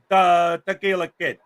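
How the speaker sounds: slow, drawn-out Chicago accent on the name; host demos the name for his cohost, Chicago accent. Shown as a demonstration. Mock Chicago accent, said slowly. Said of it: "Well, then use your Chicago accent".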